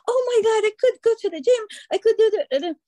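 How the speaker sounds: high, loud, rushing excitement; excited hyper self. Retold or imitated. Telling her coach about her plans, high and excited. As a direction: gush like a hyper client. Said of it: "And you are so calm".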